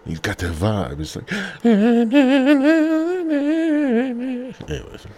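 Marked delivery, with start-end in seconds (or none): 1.4-4.6 a sudden high startled gasp; sharp gasp. Real, not acted. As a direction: catch your breath in surprise. Startled mid-sentence.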